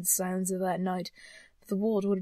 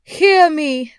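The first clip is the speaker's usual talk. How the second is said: loud, high ghostly shout; commanding cry; 7 semitones higher, 14 dB louder than usual. A narrator's reading. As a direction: demand attention at full voice. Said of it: "cried the ghost".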